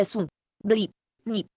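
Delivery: "su, bli, ni" spoken one syllable at a time; despărțit în silabe. Shown as a demonstration. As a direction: separate "su", "bli" and "ni" with clear pauses. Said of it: "se desparte în silabe". („su, bli, ni” rostit rar, silabă cu silabă)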